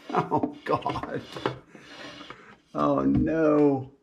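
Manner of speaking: high, strained groans of disbelief; gasping oh no; shocked dismay. Real, not acted. Discovering the damage.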